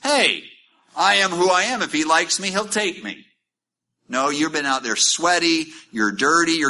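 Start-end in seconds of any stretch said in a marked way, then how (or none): 0.0-3.3 high, cocky and careless; sassy defiance. Pretend. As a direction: toss it off like a proud diva. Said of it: "Ruth could have says".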